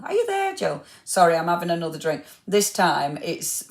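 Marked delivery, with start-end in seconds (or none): 0.0-0.8 pitched way up, calling to someone offscreen; high questioning call to a silent friend. Real, not acted. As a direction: call out for Jill, checking she's there. Calling out to absent Jill.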